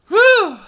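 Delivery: loud high sigh of relief; relieved whew. Real, not acted. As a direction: blow out the tension. Boulder stops just in time.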